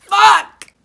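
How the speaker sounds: a sudden loud high yelp; loud acted exclamation. Reading smut aloud to viewers, a loud high yelp. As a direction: blurt the word out loud, up high. Reading a steamy fanfic line.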